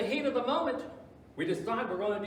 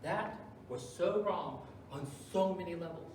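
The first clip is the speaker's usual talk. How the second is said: normal talking voice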